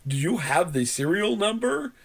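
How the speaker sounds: imitated tech support questioner; over-enunciating. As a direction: ask it syllable by syllable, scripted. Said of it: "but he was over enunciating".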